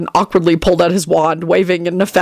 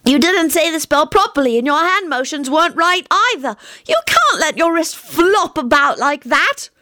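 exasperated Teddy; 10 semitones higher than usual. A narrator's reading. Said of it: "in an exasperated voice".

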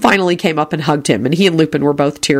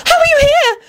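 put-on very high-pitched girl's squeal; shrill, thrilled disbelief; excited shriek. A narrator's reading. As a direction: squeak it out in amazed delight. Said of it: "Rosie shrieked excitedly".

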